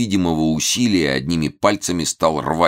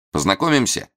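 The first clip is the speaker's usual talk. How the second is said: cheerful; 6 semitones higher than usual. A narrator's reading. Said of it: "весело спросил".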